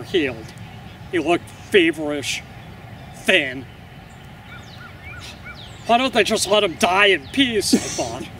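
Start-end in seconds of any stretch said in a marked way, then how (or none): none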